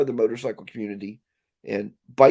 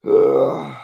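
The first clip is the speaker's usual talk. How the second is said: loud, drawn-out groan of disgust; real groan of exasperation; exasperated groan; 7 dB louder than usual. Real, not acted. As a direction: let out a heavy, fed-up "ugh". Exasperated groan at drive-through orders.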